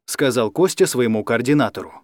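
ordinary delivery, nothing marked

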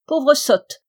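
mother snaps at her daughter's tale; scornful outcry. A narrator's reading. Mother scolds her foolish daughter, loud scornful cry. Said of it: "s'écria sa mère".

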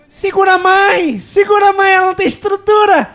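very high, slow falsetto shriek. Acted. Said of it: "Eu posso fazer o, ó".

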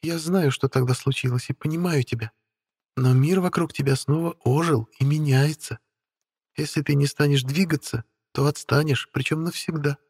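high, light voice of a spoiled beauty; haughty young woman voice. Acted. Witch Stella speaking. (капризная молодая ведьма)

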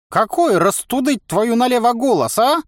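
cackling toothless ranting. Acted. Old man ranting.

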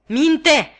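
high, very loud furious yell; savage shout. A narrator's reading. Convict shouting down his accuser, savage and loud. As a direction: explode at the liar. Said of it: "strigă ognașul meu cu o vehemență sălbatică".

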